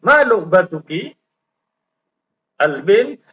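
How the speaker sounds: dialogue read aloud with rising questions and falling answers; reading with intonation. Shown as a demonstration. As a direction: lift every question, settle every answer. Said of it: "Jadi, harus ada intonasinya, ada naik turunnya". (membaca percakapan dengan intonasi naik turun)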